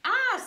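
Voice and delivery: high, loud satisfied ah; bear's loud ah. Acted. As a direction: bellow out the bear's ah. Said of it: "says the bear".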